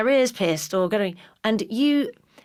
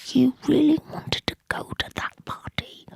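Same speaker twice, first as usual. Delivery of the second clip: high, hushed self-scolding whisper; self-reproach; 5 semitones higher than usual. Retold or imitated. Locked in the loo, whispering at herself. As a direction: scold yourself under your breath.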